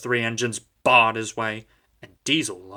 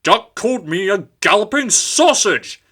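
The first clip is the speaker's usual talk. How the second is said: loud, higher sputtering complaint; spluttering; 10 semitones higher, 10 dB louder than usual. A narrator's reading. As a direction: splutter with outrage. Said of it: "spluttered Golden".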